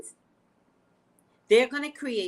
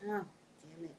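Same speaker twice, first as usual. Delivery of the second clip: ordinary talk, nothing marked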